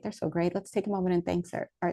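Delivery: usual speaking voice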